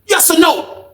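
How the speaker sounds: fast, high yelling demand; angry demand. Retold or imitated. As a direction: bark the ultimatum, yes or no. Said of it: "He said".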